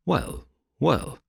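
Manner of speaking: a touch of nervous hesitation; lightly voiced jittery patient. A narrator's reading. Resident patient dodging Holmes's question, mildly nervous. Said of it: "in a nervous fashion".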